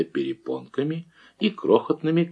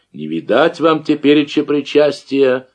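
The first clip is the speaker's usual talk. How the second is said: mock horror; 5 semitones higher, 5 dB louder than usual. A narrator's reading. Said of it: "в притворном ужасе закричал".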